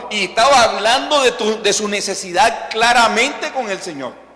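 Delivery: loud, high, insistent pulpit delivery; impassioned preaching. Acted. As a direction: preach her pleading prayer with full force. Preaches Ana's prayer at full force.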